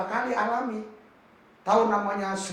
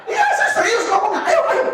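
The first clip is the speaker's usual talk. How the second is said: much higher, louder, laughing challenge; laughing dare; 10 semitones higher, 3 dB louder than usual. Retold or imitated. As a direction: egg him on, grinning. Daring the officer to fly.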